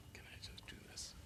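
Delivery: whispered quick aside. Real, not acted. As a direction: whisper it off-mic to yourself. Quick aside while handling device.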